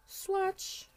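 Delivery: sing-song it for fun; high squeaky playful word; silly high voice. Pretend.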